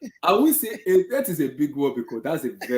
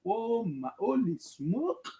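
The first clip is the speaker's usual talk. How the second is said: stunned; 6 semitones higher, 9 dB quieter, much slower than usual. Retold or imitated. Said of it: "I'm like".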